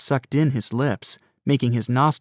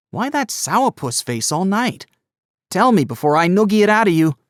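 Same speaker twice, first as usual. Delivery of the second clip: teasing cousin; 8 semitones higher, 5 dB louder than usual. A narrator's reading. Gus teasing his cousin.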